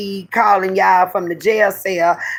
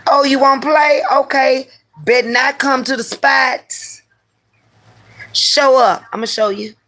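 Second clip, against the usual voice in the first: heated threat; 6 semitones higher than usual. Retold or imitated. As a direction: threaten him hot. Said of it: "getting real heated now".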